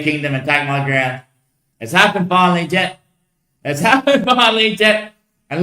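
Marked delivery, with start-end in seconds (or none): 3.6-5.1 laughing it out, higher. Real, not acted. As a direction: say it again through a laugh. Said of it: "repeated with a laugh".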